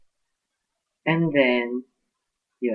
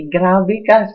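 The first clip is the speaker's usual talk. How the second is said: much louder and higher on ka; indignant outburst; 8 semitones higher, 11 dB louder than usual. Real, not acted. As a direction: throw the accusation at him. Bumubulalas sa galit. (malakas at mataas na panunumbat)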